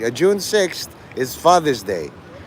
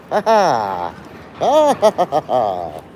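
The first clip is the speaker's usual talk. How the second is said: drawn-out theatrical villain laugh; Joker laugh; much slower than usual. Acted. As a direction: put on a slow supervillain laugh. Addresses Batman as the Joker.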